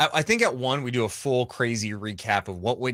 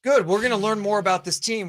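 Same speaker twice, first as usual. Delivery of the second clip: lightly voiced easygoing fans, high-pitched; voicing unworried fans; 9 semitones higher than usual. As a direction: wave it off as the fans would, pitched up. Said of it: "fan base that's like".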